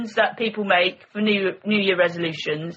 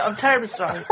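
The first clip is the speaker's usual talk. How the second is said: ordinary delivery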